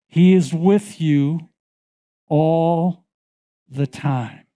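slow, weighted preaching emphasis. Acted. Preacher assuring the congregation, slow and weighted. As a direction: lean into each word.

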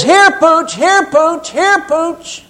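high-pitched dog call, sung out; hollering for dog. Acted. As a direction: call the dog in from across the yard. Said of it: "he's standing there hollering".

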